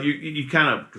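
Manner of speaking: normal talking voice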